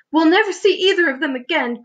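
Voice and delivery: high-pitched storybook wail; distressed cry. A narrator's reading. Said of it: "cried the ladybug".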